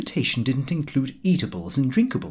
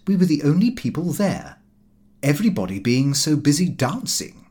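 plain reading voice

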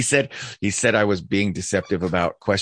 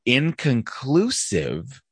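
slow ironic drawl; 5 semitones higher, much slower than usual. Real, not acted. Drawn-out ironic punchline.

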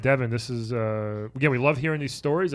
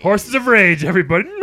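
loud, bright title call; excited announcer; 8 semitones higher, 13 dB louder than usual. Acted. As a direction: announce it to the crowd. Louder announce of parody title.

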